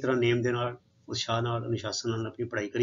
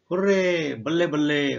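joyful exclamation; 3 semitones higher, 5 dB louder, slower than usual. Acted. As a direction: cheer like you just heard great news. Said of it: "She exclaimed with joy". (ਖੁਸ਼ੀ ਨਾਲ ਉੱਚੀ ਆਵਾਜ਼ ਵਿੱਚ ਬੱਲੇ ਬੱਲੇ)

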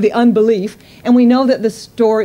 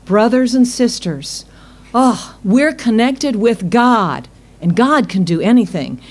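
usual speaking voice

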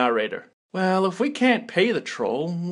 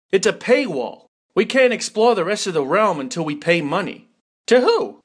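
ordinary delivery

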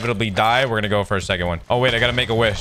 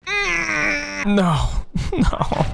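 anguished no; much slower than usual. Real, not acted. Loses his gear, dismay.

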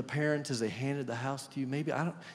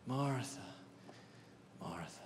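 second Martha much lower and softer; tender hushed call; 4 semitones lower, 12 dB quieter than usual. Acted. Jesus softly repeats Martha's name, fading to a hush. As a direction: let the name fall away softly.

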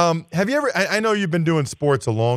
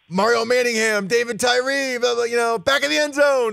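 excited play-by-play; 5 semitones higher than usual. Retold or imitated. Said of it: "You get excited".

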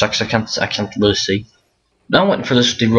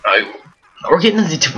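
usual speaking voice

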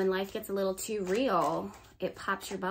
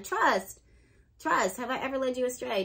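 higher, punched insistence; emphatic insistence; 4 semitones higher than usual. Real, not acted. Reader asking if she ever led Pisces astray, emphatic insistence. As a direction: press them hard to believe you. Insisting viewers trust her.

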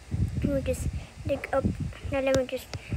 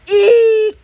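high, loud long squeal; 7 semitones higher, 17 dB louder than usual. Pretend. Child playing with numbers, squealing. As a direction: squeal it out shrilly.

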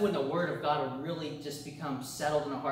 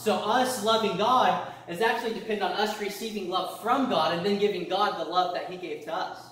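raised, louder preaching voice; 4 semitones higher, 6 dB louder than usual. Acted. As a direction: preach it out to the church. Preaching with force.

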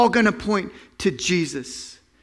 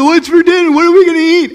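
put-on high, loud whiny kid voice; whining kids; 9 semitones higher, 8 dB louder, faster than usual. Preacher imitating whiny children asking for dinner, loudly. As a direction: whine like a hungry child.

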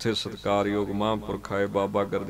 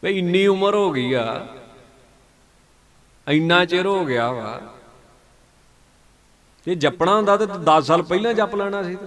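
exclaiming; 7 semitones higher, 5 dB louder than usual. Real, not acted. Preacher exclaims at full force.

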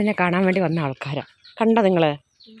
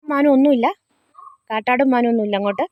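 calling out; 5 semitones higher, 5 dB louder than usual. Real, not acted. Calling out to visiting kids.

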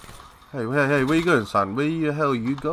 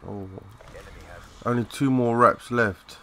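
ordinary talk, nothing marked

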